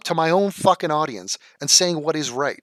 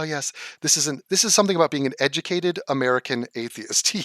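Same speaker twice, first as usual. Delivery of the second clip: ordinary talk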